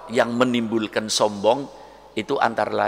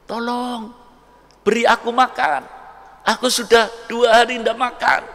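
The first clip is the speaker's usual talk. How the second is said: begging beggar; 10 semitones higher than usual. Acted. Starving beggar at the pious man's door, high shouted plea.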